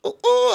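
high-pitched imitated hooting; crazed high hoots; weird animal noises. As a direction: whoop "ooh ooh" like the wild man. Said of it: "you know, all this shit".

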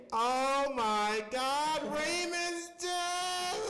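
high, long-drawn imitated wail held back in volume; mock wail. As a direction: stretch it into a thin, hammed-up cry. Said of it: "Loud as day".

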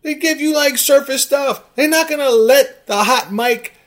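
loud, high-pitched tirade; exasperated rant. Real, not acted. Host berating a commenter about what coaches say, exasperated. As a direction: keep escalating into shouted insults.

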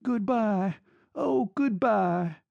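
a desperate final farewell; wild, broken voice. A narrator's reading. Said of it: "in a wild, broken voice".

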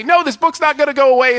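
high, loud defiant no; mock defiant shout. Knights refusing the witch, a high hammed-up shout.

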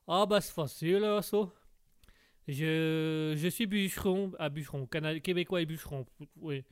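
Québécois lumberjack voice; Québécois accent. Acted. Québécois lumberjack presents himself to the host, broad Québécois accent. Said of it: "le Québécois".

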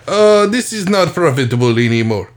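lightly voiced Israeli-Russian accent; a hint of mixed Israeli-Russian accent. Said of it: "merged my Israeli and Russian accent".

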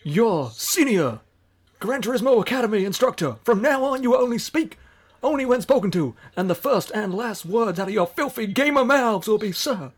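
barking drill instructor, loud and high; drill sergeant. Acted. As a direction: bark orders like a drill sergeant. Drill sergeant intro skit.

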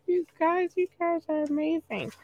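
high, soft gush. Real, not acted. Thanking Singapore listeners, high and soft gushing. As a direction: coo it high and sweet.

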